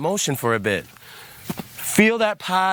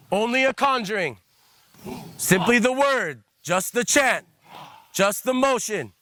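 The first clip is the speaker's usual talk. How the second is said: raised, measured guiding voice over the chant; guided trance leading; 7 semitones higher than usual. Acted. Leads a trance movement.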